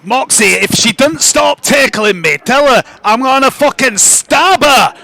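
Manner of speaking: irate little creature, rising in pitch; exasperated creature building to an outburst; threatening mushroom creature. Acted. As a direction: threaten violence through a friend, fed up. Game master plays threatening mushroom creature.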